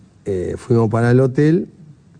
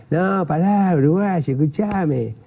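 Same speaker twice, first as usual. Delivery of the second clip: higher-pitched mock placating voice; imitated backpedalling; 6 semitones higher, slower than usual. Fan who threatened the player now backs down, imitated placating. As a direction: imitate someone hurriedly calling off a fight. Challenger backs down when confronted.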